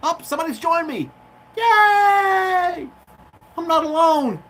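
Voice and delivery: high, loud delighted cheer; thrilled shout. Real, not acted. Vlogger cheering when a viewer joins his stream, excited shout. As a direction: burst out with joy at a visitor.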